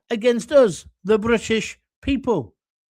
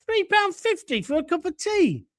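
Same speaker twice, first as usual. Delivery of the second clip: outraged disbelief; 8 semitones higher than usual. Real, not acted. Outraged by tea price.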